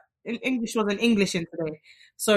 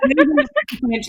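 mock French accent, quick and a bit higher; 5 semitones higher, 4 dB louder, much faster than usual. Host hamming a French accent for her guest. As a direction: put on a jokey French accent. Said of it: "I was just practicing my French accent".

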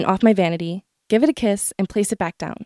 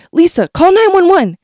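higher, louder urgent call; shouting for help; 8 semitones higher, 5 dB louder than usual. Retold or imitated. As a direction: yell for the mother in panic. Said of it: "He shouts for my mother".

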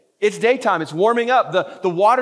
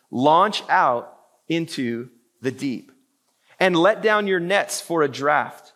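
slow, measured reading of Jesus's words; solemn scripture reading; 4 semitones lower, slower than usual. Acted. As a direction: read it slowly like scripture. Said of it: "he said unto Simon".

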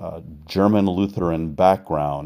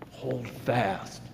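"hold fast" dropped to a near hush; quiet repeated hold fast; soft echo; 4 semitones higher, 11 dB quieter than usual. Acted. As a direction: echo it back softly, inward.

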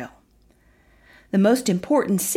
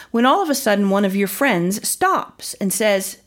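usual speaking voice

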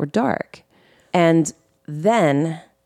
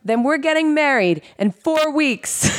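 high, bold counteroffer; mock ultimatum; 9 semitones higher than usual. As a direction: fire back a dare. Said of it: "I'm like".

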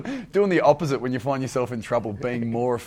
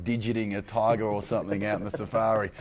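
ordinary delivery

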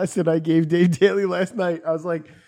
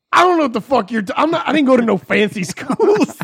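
loud, higher mock yell; imitated yelling; 5 semitones higher, 5 dB louder than usual. Imitating Daly yelling at his director about schooling, mock yelling. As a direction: protest loudly, imitating a defensive yeller. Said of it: "He starts yelling at me".

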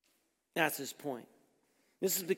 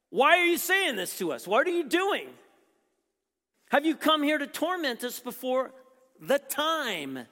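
demon protesting; 5 semitones higher, 5 dB louder than usual. Retold or imitated.

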